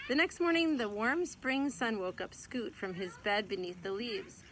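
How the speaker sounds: fresh start, warm spring sun said clearly; clean retake. Acted. Mother rereading the tripped line for her child, careful and clear. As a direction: start the sentence over and read it smoothly. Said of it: "Wow, I got tongue-tied".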